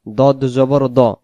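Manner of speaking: দদ জবর দ, slow, with a thick rounded "do"; heavy Dad. Shown as a demonstration. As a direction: round দ to a full "o". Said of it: "দা পড়া যাবে না".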